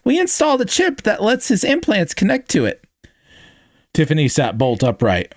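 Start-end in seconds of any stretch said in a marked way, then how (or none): 0.0-2.8 very high-pitched squeak of excitement; excited little squeaky character. A narrator's reading. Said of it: "his squeaky voice reaching an even higher pitch".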